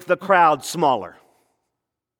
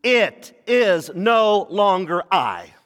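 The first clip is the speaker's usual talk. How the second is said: each word slow and weighty, It is no longer I; much slower than usual. Shown as a demonstration. Preacher reads the five powerful words to the church, slow and weighty. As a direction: lay each word down slowly. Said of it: "five of the most powerful words".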